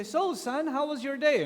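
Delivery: high, upbeat prodding question; father fishing for conversation, high and bright; chirpy dad question. Acted. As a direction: try to sound cheerful to get him talking. Father questioning teenage son.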